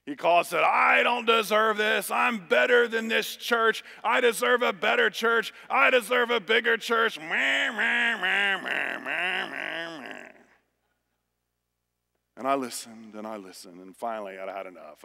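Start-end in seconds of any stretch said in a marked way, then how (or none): 0.6-7.2 mock a self-pitying pastor; whiny complaint. Friend the minister griping about his appointment, high whiny voice. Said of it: "complaining about his appointment". 7.2-10.3 mocking meh. Mocking the friend's complaining.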